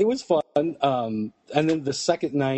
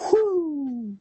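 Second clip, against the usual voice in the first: mock whoosh of flying glasses; 13 semitones higher than usual. Glasses flying off, sound effect.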